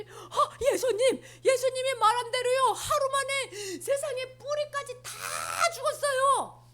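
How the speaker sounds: high, laughing astonishment; amazed disciples. Acted. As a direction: marvel that his word came true. Disciples amazed at dead tree.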